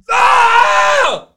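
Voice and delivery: high, very loud long scream; full-throated scream of a man who fell. Retold or imitated. Friend falls while on the phone, screaming. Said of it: "then he starts screaming on the phone".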